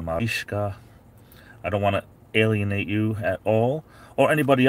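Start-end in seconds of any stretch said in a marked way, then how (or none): 0.0-0.8 "Mariska" with long ee and sh, mah-REESH-kah; European-style pronunciation. Shown as a demonstration. Streamer asks viewer about her name, "Mariska" said as mah-REESH-kah. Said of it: "Is it more like a".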